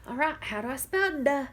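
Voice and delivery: normal talking voice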